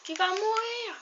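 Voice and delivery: high excited shout. Real, not acted. Attacking mob in game.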